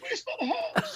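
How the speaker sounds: very high squeal with laughter in it; squeaky put-on crying voice; mock tearful cry. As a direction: imitate blubbering, half laughing. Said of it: "And we're crying".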